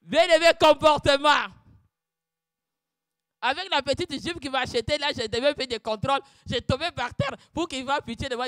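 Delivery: loud preaching testimony; fervent sermon delivery; preaching at full force. Acted. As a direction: drive the lesson home.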